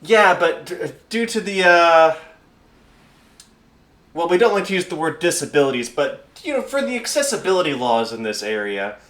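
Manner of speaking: high, halting bureaucrat; awkwardly careful high-voiced official; pencil-pushing clipboard official. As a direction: tiptoe through the official wording. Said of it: "pencil-pushing motherfucker with a clipboard".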